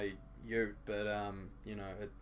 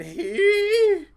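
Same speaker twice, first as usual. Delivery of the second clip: shrieked far higher and much louder; absurd squeal; 19 dB louder than usual. Shown as a demonstration. Reading the text-to-speech moan, shrieked very high and loud. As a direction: squeak it out like a cartoon orgasm. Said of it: "It said".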